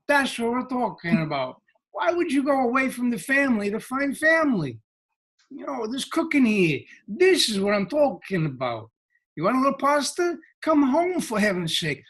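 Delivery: high-pitched hammed-up Brooklyn accent; Brooklynese. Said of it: "We put it in Brooklynese".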